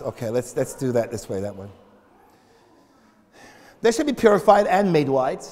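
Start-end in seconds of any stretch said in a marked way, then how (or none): 0.0-1.7 soft, low musing voice; quiet God voice. Retold or imitated. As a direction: murmur like tinkering with code. God rewriting the code.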